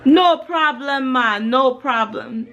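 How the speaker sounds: slow, badly faked Jamaican lilt; hammed-up Jamaican, starting high; bad Jamaican accent. Shown as a demonstration. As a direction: put on a clumsy Jamaican accent, drawn out. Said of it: "No, that's so wrong ... I am the worst Jamaican".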